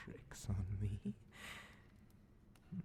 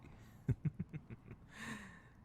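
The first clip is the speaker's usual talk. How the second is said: sinister chuckle; 9 semitones higher, 3 dB quieter than usual. Acted. Demon amused at discovery.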